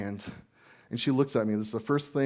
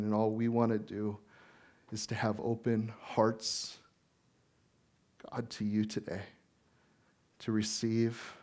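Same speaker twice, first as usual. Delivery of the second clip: prayer; 5 dB quieter than usual. Acted. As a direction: pray softly over the congregation. Opening prayer before the sermon.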